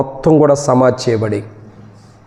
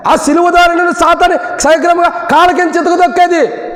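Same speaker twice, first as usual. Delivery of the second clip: preaching at full force; 11 semitones higher than usual. Acted. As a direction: preach it out to the whole hall. (పూర్తి శక్తితో బోధించే స్వరం)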